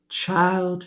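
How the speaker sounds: put-on high, fond old woman; high kindly old woman. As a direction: call her child tenderly. Gloria Dump speaks in story.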